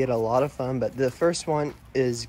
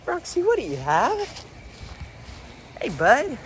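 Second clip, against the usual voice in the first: higher, coaxing pet voice; doggy talk; 10 semitones higher than usual. Real, not acted. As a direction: coax the dog sweetly. Talking to the dog.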